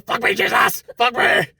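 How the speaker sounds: a light loud imitation of her moaning; imitating her moaning. Friend imitating the woman in the video, mock loud moaning.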